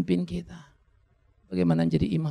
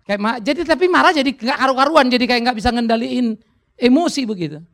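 higher and louder, heated as he relives it; worked-up telling; 8 semitones higher, 7 dB louder than usual. Real, not acted. Pastor confessing his uncontrolled anger, worked-up telling. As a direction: let the agitation rise as you confess. Recalling losing his temper.

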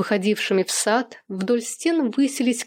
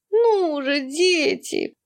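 much higher, mock childish whine; a grown man whining in a high squeak; squeaky whining; 6 semitones higher, much slower than usual. A narrator's reading. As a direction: pester the kids like a squeaky little boy. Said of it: "пискляво конючил Сэм".

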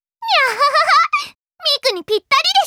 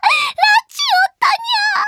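gleeful shout; 12 semitones higher, 3 dB louder, much faster than usual. Acted. Gloating over dumping the fish.

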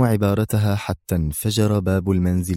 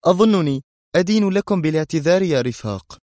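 higher, resolute admission; firm; 8 semitones higher than usual. A narrator's reading. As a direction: own up resolutely. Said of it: "قبل أن تقول في حزم".

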